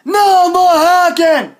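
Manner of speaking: high, loud, slow shout; furious yell. Acted. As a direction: drag out the shouted demand. Raging at hackers.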